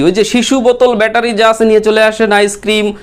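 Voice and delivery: high, even street hawker's chant; ফেরিওয়ালা. Retold or imitated. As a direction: call it out like a street vendor hawking wares. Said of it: "এরকম বলে না অনেকে ফেরিওয়ালারা". (ফেরিওয়ালার সুরে চড়া গলায় হাঁক)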